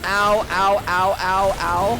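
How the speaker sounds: high, loud pained yelps; high yelping ows. Real, not acted. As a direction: yelp each hit. Character taking hits in game.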